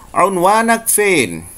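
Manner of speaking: slow Egyptian "unwanak fein"; Egyptian Arabic. Shown as a demonstration. Teacher showing students the Egyptian version. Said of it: "orang Mesir akan mengatakan".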